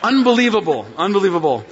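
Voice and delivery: laughing through the word. Real, not acted. Pastor amused at son's hearing, laughing. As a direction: chuckle it out in disbelief.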